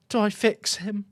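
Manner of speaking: usual speaking voice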